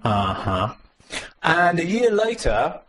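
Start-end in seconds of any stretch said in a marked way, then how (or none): 0.1-0.8 skeptical uh-huh. Retold or imitated. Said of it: "And we went".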